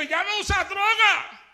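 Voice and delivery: mocking old friend. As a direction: scoff at the new believer. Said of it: "empezó a reírse de mí".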